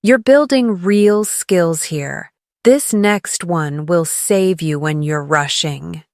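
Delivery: normal talking voice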